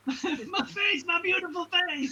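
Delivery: high, hammed-up Italian American lament; mock-anguished Italian American mobster; crappy Italian American accent. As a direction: wail like a wounded cartoon Italian American gangster. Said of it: "crappy Italian American accent".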